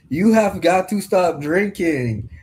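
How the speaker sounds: light Russian doctor impression; Russian accent. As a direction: mimic the eye doctor's Russian accent, slow and earnest. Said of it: "Only because of that accent".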